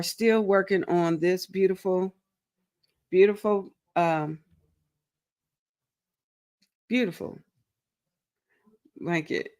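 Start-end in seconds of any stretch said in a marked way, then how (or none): none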